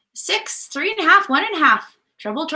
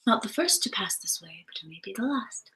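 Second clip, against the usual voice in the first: whispered movie line, much softer; 5 semitones lower, 10 dB quieter than usual. Pretend. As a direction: breathe it like a mysterious quote. Disney World reference, whispered.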